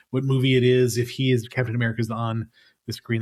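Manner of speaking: ordinary talk, nothing marked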